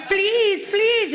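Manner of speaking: high, mock desperate begging; mock pleading. Mimicking the dragged lady begging, high mock desperation. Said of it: "she's going".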